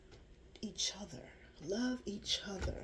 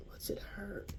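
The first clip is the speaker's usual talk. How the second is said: whispered; 8 dB quieter, slower than usual. Shown as a demonstration. Repeated, second whispered.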